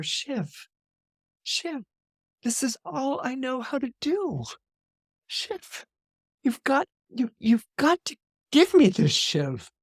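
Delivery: hushed, halting, breaking plea; broken plea. Retold or imitated. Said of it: "he has lost his soul".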